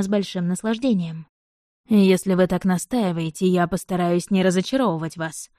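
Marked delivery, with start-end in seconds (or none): none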